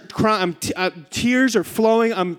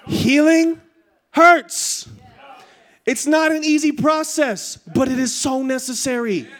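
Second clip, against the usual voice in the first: preach; 7 semitones higher, slower than usual. Real, not acted. Preacher telling congregation that healing takes work, emphatic preaching. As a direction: drive the point home to the congregation, stretched and forceful. Preaches the point at full force.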